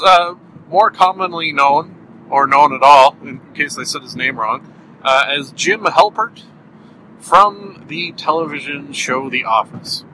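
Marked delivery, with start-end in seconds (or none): none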